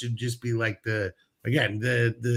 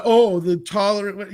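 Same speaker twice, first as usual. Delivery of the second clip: drawn-out mock quote, higher and louder; 5 semitones higher, 5 dB louder, slower than usual. Host parroting opponents who claim tolerance, mocking. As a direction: stretch it out with exaggerated scorn.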